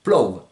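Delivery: PLOU with an open o gliding to ou; low, falling plou, open Provençal o; open o diphthong. Shown as a demonstration. Teacher showing the grave accent's o, Provençal pronunciation. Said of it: "qui va donc vous donner ce o".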